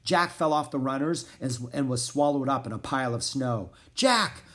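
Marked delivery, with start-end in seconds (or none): 3.9-4.4 high, loud cry of a name. A narrator's reading. Annie searching for fallen Jack, crying out. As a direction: shout for a lost companion. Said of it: "cried Annie".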